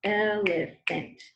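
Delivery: slow, syllable by syllable: "Elephant" as el-e-phant; teacher slowly sounding out "Elephant"; syllable demo. Shown as a demonstration. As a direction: stretch "Elephant" out to tap each syllable. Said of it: "Let's see how many times we snap our fingers".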